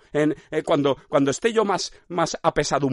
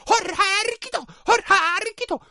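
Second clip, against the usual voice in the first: very high sing-song clown cry; colourful pain cry. Pretend. Clown screaming in pain, high sing-song squeal. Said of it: "gritaba de dolor, pero con".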